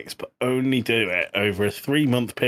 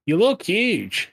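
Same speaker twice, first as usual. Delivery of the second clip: high, slow mock gym bro compliment; 9 semitones higher, 3 dB louder, slower than usual. Complimenting his co-host as a gym bro, high and slow. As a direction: stretch out the compliment like a gym regular.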